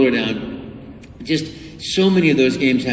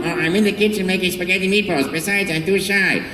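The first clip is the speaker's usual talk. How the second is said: shy Luigi, a bit quicker; 7 semitones higher than usual. Retold or imitated. Luigi hiding in the kitchen, shy and quick. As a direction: mumble excuses like a bashful brother. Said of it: "And then I'd go".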